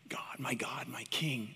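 breathy hushed devotion to God; whispered prayer. Acted. Believer whispering love to Jesus, hushed prayer.

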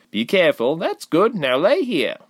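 high, mildly stern caring voice; empathy and sternness. A narrator's reading. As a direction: guide her firmly but kindly. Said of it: "a strange mix of empathy and sternness".

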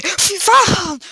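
sudden high yell of fright; high, loud jump-scare cry; startled scream. Real, not acted. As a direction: shriek as the scare hits. Jump scare after counting down.

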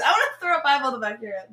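fast, laughing threat. Real, not acted. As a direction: joke it through a laugh.

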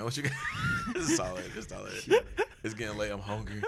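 ordinary talk

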